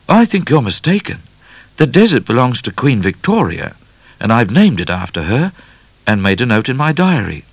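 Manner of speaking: put-on high, loud boyish voice; boy correcting explorer. A narrator's reading. Midnight correcting the explorer, high insistent boy. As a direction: pipe up, sure of yourself.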